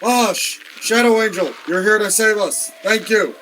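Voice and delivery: high-pitched, grateful exclaiming; acted relieved excitement. Spilled captive thanking Shadow Angel for saving him, excited. Rescued character greets his saviour.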